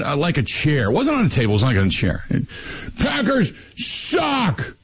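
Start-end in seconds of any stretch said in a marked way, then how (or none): 3.0-4.8 very slow, high, stretched-out yell; drunk shout. Retold or imitated. Drunk fan on a chair yelling at the crowd, slow high shout. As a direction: bellow the taunt from a chair. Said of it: "he's just yelling".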